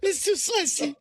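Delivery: high, exaggerated British accent; bad British accent. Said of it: "worst British accents ever".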